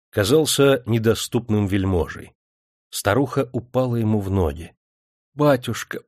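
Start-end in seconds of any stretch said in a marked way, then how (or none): 5.3-6.0 pleading. A narrator's reading. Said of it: "взмолилась она".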